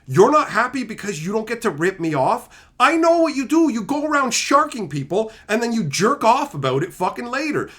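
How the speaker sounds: heated rant replayed at the cheating trader; acted angry telling-off. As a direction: tear into him, retelling the row.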